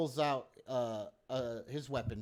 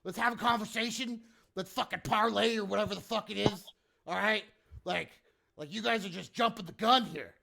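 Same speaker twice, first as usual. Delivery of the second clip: higher-pitched, exasperated peacemaking; exasperated appeal; 7 semitones higher than usual. Acted. Hobgoblin urging players to have a conversation, exasperated. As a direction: talk the hotheads down, voice still worked up. Hobgoblin urges intruders to talk.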